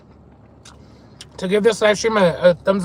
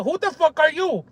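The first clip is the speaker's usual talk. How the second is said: higher-pitched mimicked punchline; mock-incredulous challenge, pitched up; imitated comic delivery; 5 semitones higher, 3 dB quieter than usual. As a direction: copy the comedian's turnaround line. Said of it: "the way he says".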